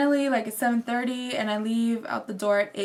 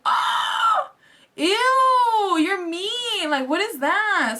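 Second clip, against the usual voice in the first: high, loud squealing ew; mock disgust; 8 semitones higher, 3 dB louder, much slower than usual. Shrieking at the rude Target worker, high mock disgust. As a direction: shriek it back at her like she's gross. Mocking rude store clerk.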